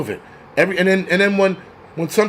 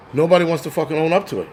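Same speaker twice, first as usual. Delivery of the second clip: ordinary talk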